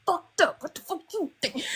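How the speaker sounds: tossing back a frank reply; blunt retort. Retold or imitated. Answering her friend's check-in, mock blunt. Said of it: "I'll be like".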